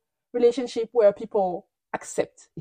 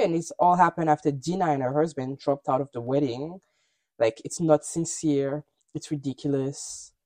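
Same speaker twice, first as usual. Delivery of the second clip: normal talking voice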